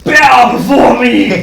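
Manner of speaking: booming dark lord command. Acted. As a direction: roar it like a conquering tyrant. Toaster taking over the world.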